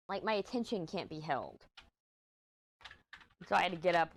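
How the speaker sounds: ordinary delivery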